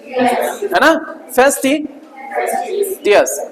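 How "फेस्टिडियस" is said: फेस्टिडियस slowly and softly, each syllable held; slow model pronunciation. Shown as a demonstration. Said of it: "फे ऊपर बोला जाएगा". (धीमा, नरम उच्चारण)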